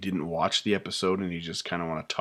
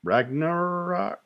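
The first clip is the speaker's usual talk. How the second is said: unsure question; 10 semitones higher, much slower than usual. Real, not acted. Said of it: "uh, that's".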